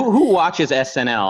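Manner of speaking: normal talking voice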